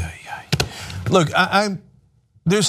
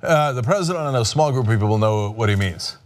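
ordinary talk

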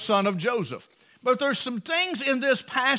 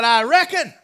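Southern reckon; 4 semitones higher, 6 dB louder than usual. Acted. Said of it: "he's a Southern boy".

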